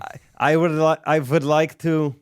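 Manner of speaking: attempted German accent. Shown as a demonstration. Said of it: "can you give me a German accent".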